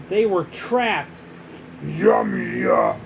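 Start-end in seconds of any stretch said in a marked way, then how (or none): 1.9-3.0 very low, loud monster voice; deep monster voice. Acted. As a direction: savor it like a hungry beast. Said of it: "Bowser said".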